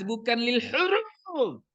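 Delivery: "bukan lil huruf" high, with "huruf" drawn long; emphatic contrast. Shown as a demonstration. Teacher corrects a likely mistake, high drawn-out "bukan lil huruf". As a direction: stretch "huruf" high to rule it out. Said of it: "Ini perhatikan".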